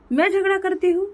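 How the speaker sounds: outraged at being called quarrelsome; indignant. Acted. Wife offended by accusation.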